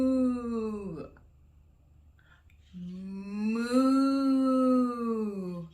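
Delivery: long, drawn-out moo; cow moo. Acted. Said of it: "A cow says".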